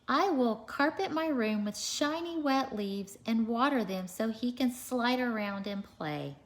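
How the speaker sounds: animated picture-book read-aloud; reading to children. A narrator's reading. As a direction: read it out to a class of little kids.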